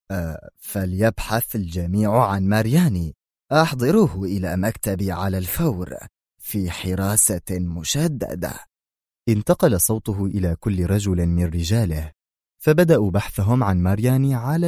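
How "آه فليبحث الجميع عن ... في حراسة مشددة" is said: ordinary delivery, nothing marked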